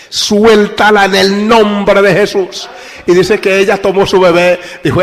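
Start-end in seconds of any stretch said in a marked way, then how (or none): 0.1-2.7 slow, loud, drawn-out command; commanding shout. Retold or imitated. Pastor commanding the mother to release the girl, slow loud shout. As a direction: order her to let go with full authority. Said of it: "ese grito imperativo".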